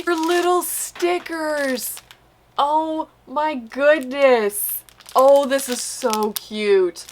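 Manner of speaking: slow, high coo of delight; drawn-out real gush at cuteness. Real, not acted. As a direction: stretch every word, gushing over something tiny. Gushes over tiny sticker kits.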